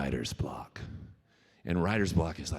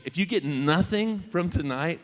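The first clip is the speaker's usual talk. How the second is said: higher and louder, pressing the point; urgent plea; 11 semitones higher, 7 dB louder than usual. Real, not acted. A speaker urging the audience to watch, pleading loudly. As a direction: plead with the crowd to listen.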